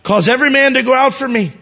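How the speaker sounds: high, loud cry to clear the room; shouted command. Acted. Joseph orders everyone out, shouted. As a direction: call it out, stretched and high. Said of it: "he cried out or shouted".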